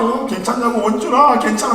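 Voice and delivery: put-on high little boy asking if his brother is ok; high, anxious child's voice; worried little boy. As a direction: voice the older son fretting over his fallen brother. Father voices worried older son.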